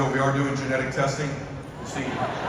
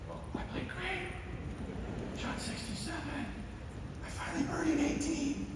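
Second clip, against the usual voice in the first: high, very soft voice of a dying dad; weak old dad, proud but fading; frail father; 12 semitones higher, 13 dB quieter than usual. Retold or imitated. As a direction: give the sick father's faint pride. Voicing dying father on phone.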